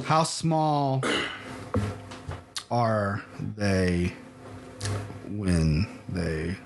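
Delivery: slow, low, halting read-out; dictating while typing. Real, not acted. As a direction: say each word as you type it, amused. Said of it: "tell me what you're typing as you do it".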